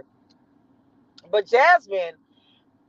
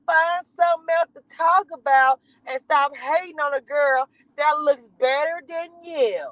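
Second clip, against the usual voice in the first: higher, slow, sassy put-on girl voice; Jasmine voice; 8 semitones higher, 3 dB louder than usual. Mimicking a reality star, slow and catty. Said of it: "I'm doing that voice".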